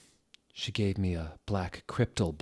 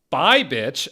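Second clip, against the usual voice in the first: higher, louder hammed-up cheeky farewell; mock cheeky goodbye; 8 semitones higher, 11 dB louder than usual. The student answering her teacher, in a hammed-up cheeky farewell. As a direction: fling the rude goodbye back cheerfully. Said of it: "I said, waving".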